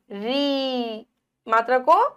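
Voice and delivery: री stretched long and slow; drawn-out long i. Shown as a demonstration. Teacher stretching री slowly for the children. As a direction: hold the री vowel out long. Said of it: "खींच रहे हैं".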